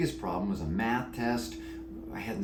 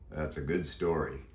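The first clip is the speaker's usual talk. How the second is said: deep croaky voice; 5 semitones lower than usual. Acted. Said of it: "said Dusty".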